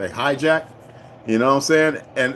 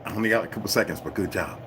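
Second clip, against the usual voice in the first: much softer, lower rushed phone voice; quick quiet praise before hanging up; hushed caller; 6 semitones lower, 7 dB quieter, faster than usual. Retold or imitated. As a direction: caller with only seconds left, whispering praise quietly.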